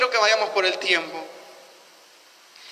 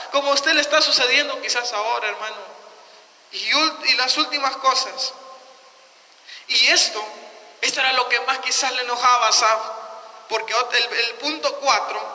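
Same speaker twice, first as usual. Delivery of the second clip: ordinary talk, nothing marked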